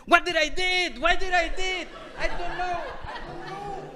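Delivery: high, frantic muttering of a man about to die; panicked man. Retold or imitated. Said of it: "this man says".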